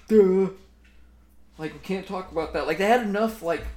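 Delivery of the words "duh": loud mock duh. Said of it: "came back up and".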